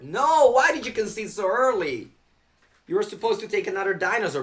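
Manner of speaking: loud, high cry of dismay; exclaimed protest. Real, not acted. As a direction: call out in protest at the opponent. Protests opponent conceding early.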